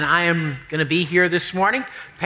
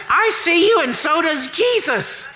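parrot voice; 10 semitones higher than usual. Acted. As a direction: shriek it like a parrot on a perch. Parrot voice in joke.

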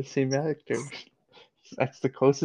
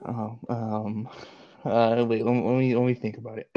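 usual speaking voice

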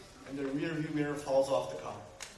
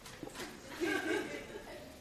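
exasperated wordless sigh; another uff; 9 semitones higher, 7 dB quieter than usual. Shown as a demonstration. Preacher showing another kind of uff, exasperated sigh. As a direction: heave a soft, irritated sigh. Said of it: "this is another uff".

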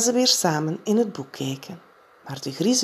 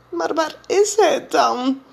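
put-on slow, higher, timid child's voice; scared little Jip; 11 semitones higher, 4 dB louder than usual. A narrator's reading. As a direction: ask in a small worried voice. (Jip vraagt nog bang, langzaam en hoog)

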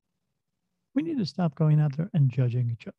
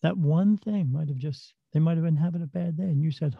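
ordinary talk

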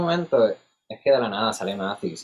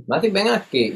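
raised high call across a room; 9 semitones higher, 4 dB louder than usual. Retold or imitated. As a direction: call him over, high and loud. Calling out to Matthew across restaurant.

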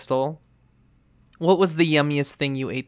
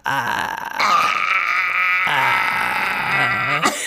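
creaky fry on a drawn-out ah; vocal fry; 5 semitones higher than usual. Shown as a demonstration. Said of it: "all of the extra vocal fry that you can ever ask for".

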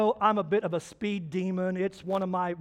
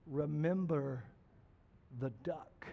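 low, soft menacing warning; quiet threat; 6 semitones lower, 12 dB quieter than usual. Retold or imitated. Sally threatening Johnny again, low and soft. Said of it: "And she said".

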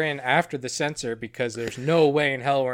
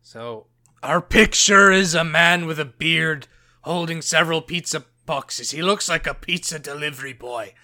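grim reaper voice; 6 dB louder than usual. Acted. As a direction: read it like a menacing specter. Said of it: "this voice isn't gonna help".

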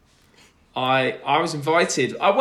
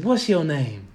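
[name] impression; 3 semitones higher, slower than usual. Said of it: "That's my [name] impression".

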